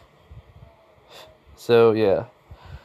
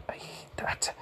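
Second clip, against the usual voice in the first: bitter whisper; 14 dB quieter than usual. Acted. Issei grumbles about being misjudged.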